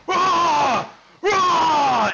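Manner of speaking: very loud, high-pitched raging bellow; long furious shouts; angry roar. Acted. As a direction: roar wordlessly, like something smashing furniture. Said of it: "an angry voice".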